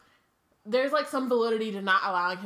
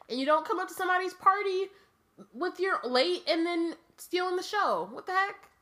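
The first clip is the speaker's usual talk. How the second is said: pitched-up exasperated rant; outraged; 10 semitones higher than usual. Real, not acted. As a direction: voice jumps high, rising indignation. Outraged rant about manners.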